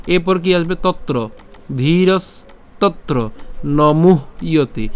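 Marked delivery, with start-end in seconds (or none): 1.8-4.9 slow split reading, মুহ and ইয়তি said apart; syllable-split demo. Shown as a demonstration. Teacher splitting the words so viewers hear each part, slowly. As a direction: break মুহ ইয়তি into two parts, slowly. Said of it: "মুহ ইয়তি অর্থাৎ ম রস্যকার হ পর্যন্ত".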